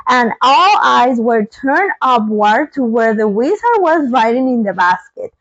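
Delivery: usual speaking voice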